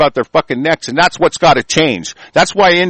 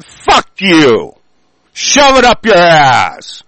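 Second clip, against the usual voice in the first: angry retort; 4 dB louder, much slower than usual. Real, not acted. Answering the mocked pious excuse.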